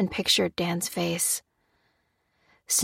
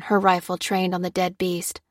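ordinary delivery

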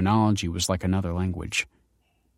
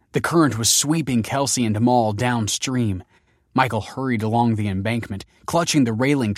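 plain reading voice

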